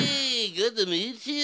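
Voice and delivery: high, slow exaggerated wail. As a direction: greet in a drawn-out singer's whine. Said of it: "this is [name]".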